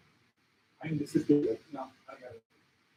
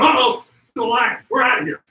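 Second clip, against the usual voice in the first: dogs fleeing; 10 dB louder than usual. Retold or imitated. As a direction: voice the dogs bolting from the lion. Dogs voiced fleeing the lion.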